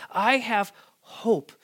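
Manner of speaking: slow, high, every word weighted; slow emphatic declaration. Real, not acted. Preacher proclaiming hope to his church, slow and emphatic. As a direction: land each word of I have hope.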